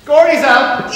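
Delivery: high, loud, gleeful taunt; jeering high-pitched taunt at a card loser; gloating. Acted. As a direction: crow it at the loser like a mocking kid. Teddy gloats again.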